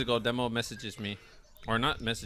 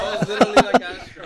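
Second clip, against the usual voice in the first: much louder, cracking up; loud laughing; 10 semitones higher, 14 dB louder than usual. Real, not acted. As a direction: burst out laughing through it. Repeated, louder through laughter.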